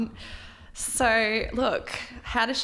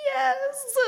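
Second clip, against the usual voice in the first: tiny high squeak; squeaked yes. Retold or imitated. Retelling the bride's answer, a tiny high squeak. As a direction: squeak it out timidly. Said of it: "she squeaks out".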